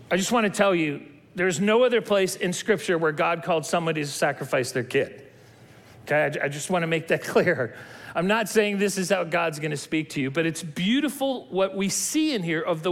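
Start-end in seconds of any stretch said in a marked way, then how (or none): none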